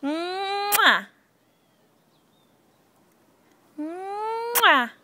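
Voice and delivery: drawn-out smacking kiss; long playful mwah; kiss sound. Shown as a demonstration. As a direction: model a big kiss for the baby.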